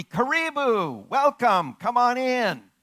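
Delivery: welcoming call. Retold or imitated. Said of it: "the response would be".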